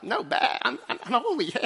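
high, laughing prim protest; mocking a churchgoer acting holy; mock holy sheep. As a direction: play a prim, self-righteous sheep, laughing. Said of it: "You're just looking all like".

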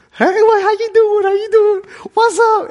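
high, loud, carefree hey-how-you-doing; breezy casual greeting. Retold or imitated. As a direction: toss off a cheerful greeting to God. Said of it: "that's the time when I'm kinda like".

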